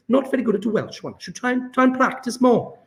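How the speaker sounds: Welsh accent. Shown as a demonstration. Chemistry tutor trying the question in a Welsh accent. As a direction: have a go at a Welsh lilt. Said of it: "So try Welsh accent".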